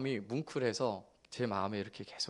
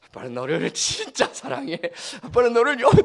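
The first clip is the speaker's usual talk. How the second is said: much higher, louder, overdone loving words; hammed-up sweet talk; 11 semitones higher, 10 dB louder than usual. Father teasing his daughter into tears, hammy and much higher. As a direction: lay it on thick on purpose. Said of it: "가식적인 말을 하는 거죠".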